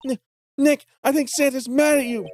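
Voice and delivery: high, squeaky cartoon voice. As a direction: pitch way up, squeaky. High put-on voice.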